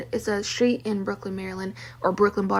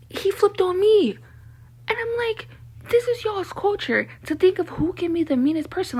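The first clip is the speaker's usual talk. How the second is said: much higher, worked-up voice; heated outrage; 9 semitones higher than usual. Real, not acted. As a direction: rise up in outraged disbelief. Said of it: "retelling heated moment".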